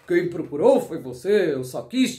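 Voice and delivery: low villain's excuse; lightly voiced sly manipulator; feigned innocence. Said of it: "tentando te convencer".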